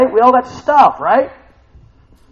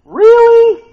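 high, loud incredulous question; mock disbelief; 10 dB louder than usual. Real, not acted. Preacher challenging the gossip excuse, loud mock disbelief. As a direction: call it out with a big high-pitched doubt. Mocks gossip passed off as prayer.

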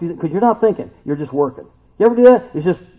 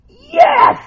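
much higher, louder joyful yelp; excited cry; 8 dB louder than usual. Retold or imitated. Peter recognizing the Lord on shore, excited yelp. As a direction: burst out like you spotted your friend. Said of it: "And Peter goes".

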